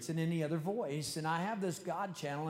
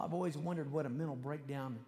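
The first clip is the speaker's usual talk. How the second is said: ordinary talk, nothing marked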